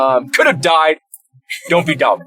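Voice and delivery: a hint of a scolding local lady; stern hostel lady. Hostel lady warning the guests, lightly voiced stern. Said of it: "lady was like".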